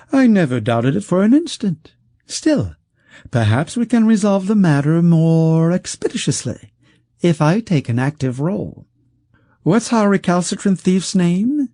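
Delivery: high, smooth, coaxing voice of a wealthy schemer; silky rich man. Acted. Wealthy schemer coaxing the outlaw, high and silky. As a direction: purr it out like a sly patron who holds all the cards.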